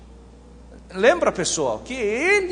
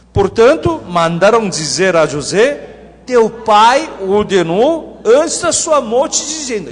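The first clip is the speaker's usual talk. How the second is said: loud, slow, measured verse reading; projected, deliberate Bible reading; scripture reading; 7 dB louder than usual. Acted. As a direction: proclaim the verse to the whole room. Reads verse sixteen aloud.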